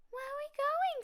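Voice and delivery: high, loud little cub question; an anxious cub asking his father; little bear voice. Acted.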